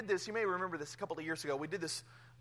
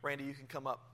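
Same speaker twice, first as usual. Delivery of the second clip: ordinary talk, nothing marked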